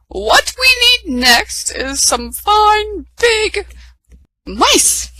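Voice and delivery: high, slow, sing-song fairy voice; fairy godmother. A narrator's reading. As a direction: announce yourself with magic.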